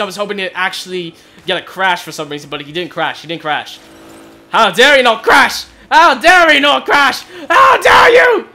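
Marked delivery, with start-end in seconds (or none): 4.5-7.2 mock angry. Mock fury at opponent car. 7.5-8.5 mock outrage shout. Mock fury at opponent car.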